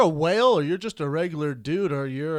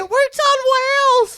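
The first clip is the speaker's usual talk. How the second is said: loud, very high whale wail; talking whale; 7 dB louder than usual. Acted. Whale endorsing the supplement, loud high wail. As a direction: cry it out as a whale. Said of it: "what was it saying?".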